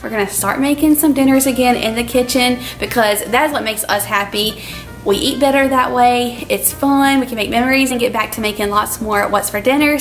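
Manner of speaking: ordinary talk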